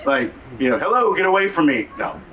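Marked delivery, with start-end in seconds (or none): 0.8-1.9 mock brush-off. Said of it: "just probably not in the proper ways".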